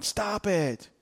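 usual speaking voice

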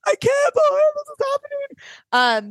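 very high, drawn-out mock sob; imitating herself sobbing in disbelief. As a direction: squeal it slowly as if overcome with tears. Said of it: "like, sobbing".

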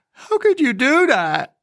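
put-on high little-girl voice, sobbing; a girl crying at the woman over her eaten rabbit, sobbing high; sobbing child. A narrator's reading. Wail it like an upset child. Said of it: "she sobbed".

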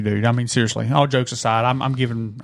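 usual speaking voice

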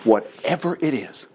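"whatever it is" said soft and slow; plain quiet reading. Shown as a demonstration. Preacher rereading Eli's words softly and slowly. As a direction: murmur "whatever it is" simply. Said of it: "He said".

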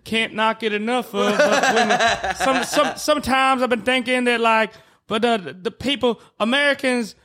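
Charles Barkley impression. Shown as a demonstration. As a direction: ramble earnestly like the TV analyst. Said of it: "Charles Barkley".

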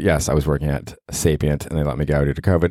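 ordinary talk

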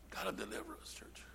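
hushed whisper to the church; telling the church softly that God will deliver. Real, not acted. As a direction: drop to a breathy whisper.

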